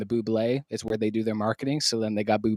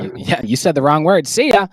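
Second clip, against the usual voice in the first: loud, higher playful ban; mock outrage; 7 semitones higher, 10 dB louder than usual. Kicking out a cohost who said Duke, mock outraged shout. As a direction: boot him out in fun.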